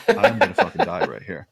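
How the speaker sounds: mock panic. Strapped-down patient telling himself he is doomed, in hammed-up panic. Same thought repeated, escalating.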